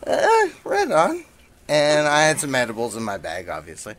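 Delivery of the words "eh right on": high, drawn-out slow eh; stunned mock agreement. To the comic next to him, high and slow. Reacting to bong hit request.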